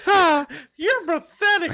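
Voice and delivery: very high, loud, drawn-out squeaky scorn; loud high-pitched puppet put-down; talking hand. Acted. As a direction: wail it slowly as a tiny mocking hand. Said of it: "It talks to you like".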